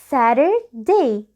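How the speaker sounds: Saturday very slowly, 'Sarer... day'; light flapped T. Shown as a demonstration. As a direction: break Saturday in two, T as a light tap. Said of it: "دي خفيفة شوية".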